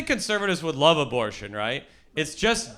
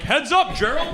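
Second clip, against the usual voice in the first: put-on old-time player; 12 semitones higher than usual. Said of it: "They're passing like".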